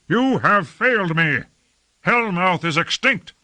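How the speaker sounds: high-pitched outcry of blame; accusing cry. A narrator's reading. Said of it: "cried Lord Fayed".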